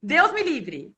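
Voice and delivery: loud burst of laughter, horrified; laughing refusal. Real, not acted. Former student laughing off a postdoc question from Diego. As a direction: laugh off the idea.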